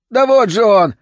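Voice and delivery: high, loud vagrant's yell; вопль. A narrator's reading. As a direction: cry out that you've spotted him. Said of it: "завопил бродяга". (истошный вопль бродяги)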